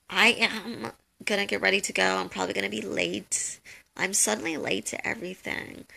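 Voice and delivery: usual speaking voice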